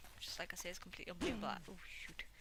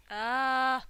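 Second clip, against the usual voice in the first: sharp loud cry of pain; pained yelp; 7 semitones higher, 16 dB louder than usual. Real, not acted. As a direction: yelp as you get hit. Hit by zombie.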